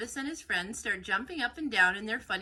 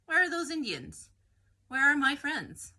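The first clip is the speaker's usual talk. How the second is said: higher and louder, anxious shout; alarmed calling out; 7 semitones higher, 7 dB louder than usual. A narrator's reading. Coyote discovering her friends gone, calling out alarmed. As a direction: call out for missing friends.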